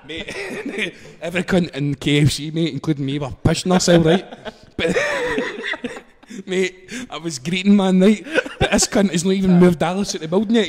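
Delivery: usual speaking voice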